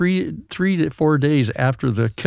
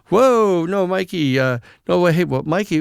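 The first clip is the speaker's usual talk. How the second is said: acted protest; 4 semitones higher than usual. Lawyer haggling with mobster client by phone, acted protest. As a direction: imitate balking at a caller's objection.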